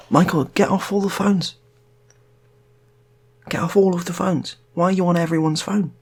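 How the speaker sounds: exasperated scolding. Acted. Wife scolds caller on phone.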